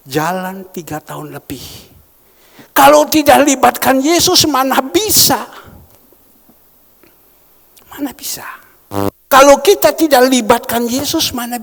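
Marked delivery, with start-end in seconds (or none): 2.7-5.6 loud, rising challenge to the congregation; loud preaching challenge; forceful preaching. Real, not acted. Preacher drives home his point. 7.9-8.4 "Mana bisa?" breathed in a whisper. Real, not acted. Repeated in a whisper.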